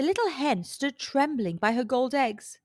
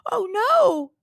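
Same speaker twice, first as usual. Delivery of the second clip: higher, louder startled cry; alarmed cry; 10 semitones higher, 7 dB louder than usual. A narrator's reading. Giant woman hears her husband coming, alarmed. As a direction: panic as her husband arrives. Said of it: "cried the giant woman".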